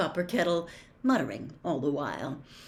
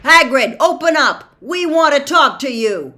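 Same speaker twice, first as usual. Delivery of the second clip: high, very loud demand; insistent full-voiced yell; shouting through door; 9 semitones higher, 15 dB louder than usual. A narrator's reading. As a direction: holler to be let in.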